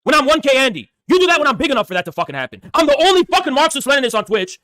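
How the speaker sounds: angry rant. Real, not acted. Streamer ranting at his chat about being brought down, heated and high. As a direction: let the frustration pour out high and hard.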